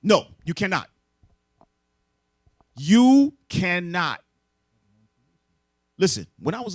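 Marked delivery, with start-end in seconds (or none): 0.0-0.9 louder, firm flat denial; emphatic no. Real, not acted. Preacher answering the crowd, loud firm denial. 2.8-4.2 very slow and louder, each word leaned on; drawn-out emphasis. Real, not acted. Preacher hammering the point home, slow and loud.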